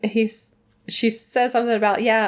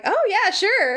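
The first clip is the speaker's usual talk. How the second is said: put-on high, swooping girlish yes; put-on perky high voice; mock eager agreement; 11 semitones higher, slower than usual. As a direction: agree in a high, over-keen voice. Voicing Daphne agreeing eagerly.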